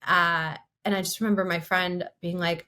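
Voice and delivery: normal talking voice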